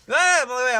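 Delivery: short, high, loud yelp; acted cry of alarm; mock scream.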